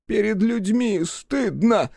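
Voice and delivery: high, slow moaning lament; ashamed groan. A narrator's reading. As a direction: groan out the shame. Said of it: "простонал и он".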